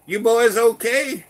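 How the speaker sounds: loud, slow call from a car window. Retold or imitated. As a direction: trooper shouting to the boys. Trooper calls out from car window.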